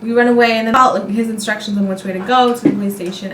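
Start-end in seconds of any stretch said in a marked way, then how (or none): none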